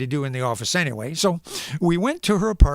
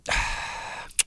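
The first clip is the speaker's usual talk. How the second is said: soft weary sigh; 7 dB quieter than usual. Retold or imitated. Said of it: "and I thought".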